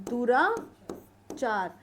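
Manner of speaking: दुराचार drawn out, split in two; slow dictation. Shown as a demonstration. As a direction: say दुराचार slowly, pausing between दुरा and चार. Teacher repeats term slowly while dictating.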